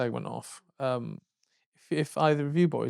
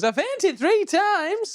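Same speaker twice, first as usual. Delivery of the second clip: much higher, slow, swooning; obsessed fan; much slower than usual. Retold or imitated. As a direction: drag it out like a starstruck devotee. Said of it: "and he goes".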